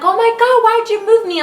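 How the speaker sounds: high, loud cry of pain and anger; pained yelling. Retold or imitated. Woman in labor yelling at the nurses in pain. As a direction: yell it out as the pain hits. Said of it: "and I'm like".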